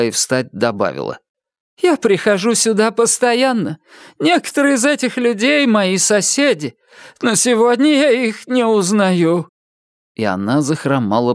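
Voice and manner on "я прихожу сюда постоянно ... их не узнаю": put-on very high, distressed old lady voice; high character voice of a rattled old woman; shaken elderly woman. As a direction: lament in bewilderment, pitched high. Elderly woman laments her neighbours.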